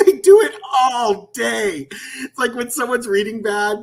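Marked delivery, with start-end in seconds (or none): 0.1-1.9 laughing. Real, not acted.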